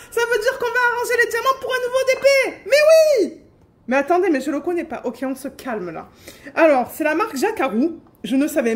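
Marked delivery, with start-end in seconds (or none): 0.1-3.4 high and fast, bursting out; thrilled. Real, not acted. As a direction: rush it out in excitement. Thrilled at new project. (voix aiguë, rapide, emballée)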